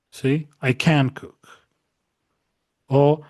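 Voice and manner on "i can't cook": emphatic "can" as in can't, lighter "cook"; stress on negative modal. Shown as a demonstration. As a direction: lean hard on "can" to make it negative. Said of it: "el énfasis va a estar aquí".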